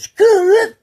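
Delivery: very high, loud whooped signal; shrill sustained call for help; gang distress call. Retold or imitated. Imitating gang member's call for backup, loud high whoop. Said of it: "I got a call".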